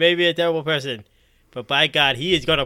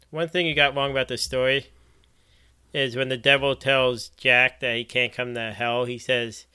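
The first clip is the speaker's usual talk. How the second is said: normal talking voice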